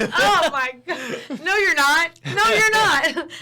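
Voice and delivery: loud, high, drawn-out disbelief; exasperated mock protest. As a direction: shoot him down loudly, pitch climbing, half laughing. Acted exasperated retort to boasting customer.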